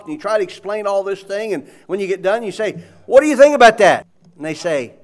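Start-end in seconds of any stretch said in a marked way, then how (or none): 3.1-4.1 eager question. Retold or imitated. Asking a listener after explaining.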